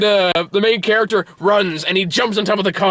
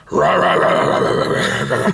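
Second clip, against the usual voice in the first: gruff, wordless growling impression; gruff accent; 14 semitones lower than usual. Mocking the police chief actor's voice, gruff growl. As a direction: growl it like a bear, low and rough. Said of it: "you can't miss that gruff accent".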